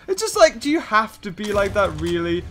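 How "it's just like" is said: ordinary delivery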